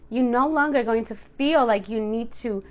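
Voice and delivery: ordinary delivery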